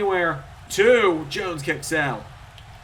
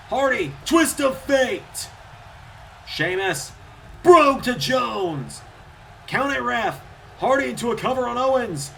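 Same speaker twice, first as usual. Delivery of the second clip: climactic call; 6 semitones higher than usual. Real, not acted. Commentator calling the elimination sequence, rising excitement. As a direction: lift the whole finish to a peak.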